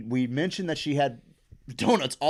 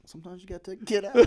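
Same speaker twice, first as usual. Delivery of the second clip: usual speaking voice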